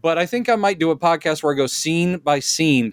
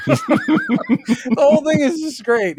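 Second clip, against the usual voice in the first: high, slow words through laughter; laughing; 13 semitones higher, slower than usual. Real, not acted. Host recounting the Zod sketch, laughing hard. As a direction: gasp it out while still laughing.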